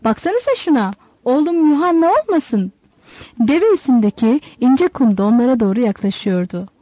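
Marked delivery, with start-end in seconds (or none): 0.0-2.7 high, eager call; pitched high with hope; excited calling. A narrator's reading. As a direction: hail him from far off. Father spots his son afar.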